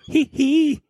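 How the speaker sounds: high falsetto hee hee. Retold or imitated. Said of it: "And he's like".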